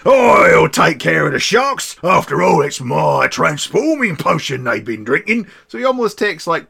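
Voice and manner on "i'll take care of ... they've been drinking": loud, slow pirate captain bellow; pirate shout. Acted. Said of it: "I cannot do this in a pirate story".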